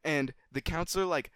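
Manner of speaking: usual speaking voice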